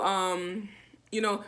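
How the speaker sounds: usual speaking voice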